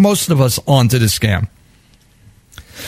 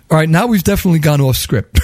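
usual speaking voice

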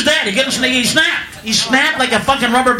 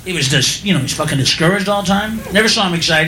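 normal talking voice

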